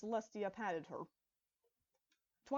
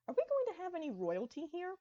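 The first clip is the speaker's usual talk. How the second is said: eager; 9 semitones higher, 3 dB louder than usual. Acted. Eager question about royalty.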